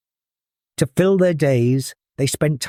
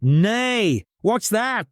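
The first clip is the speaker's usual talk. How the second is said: whinnying horse; 8 semitones higher, much slower than usual. Acted. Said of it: "the horse whinnied".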